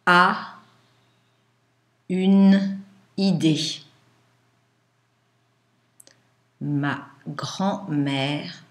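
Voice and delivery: slow, syllable by syllable dictation; dictating. Shown as a demonstration. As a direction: dictate each word with long pauses.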